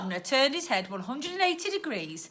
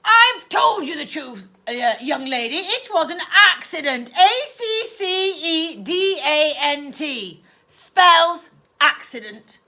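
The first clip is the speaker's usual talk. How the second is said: loud and clipped; 5 semitones higher, 8 dB louder than usual. Acted. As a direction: spell it out like bullets from a gun. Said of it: "Each word was loud and clipped".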